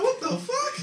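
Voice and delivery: shocked squeal. Retold or imitated. Comic staring at the dripping joint, high squeak. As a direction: squeak it, stunned.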